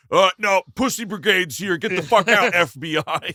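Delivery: aggressive confrontation. Acted. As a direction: yell them out of the place. Acting security crew confronting FBI agents.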